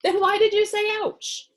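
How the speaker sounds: put-on doctor quizzing the boy, high and loud; puzzled doctor. A narrator's reading. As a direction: ask in baffled surprise.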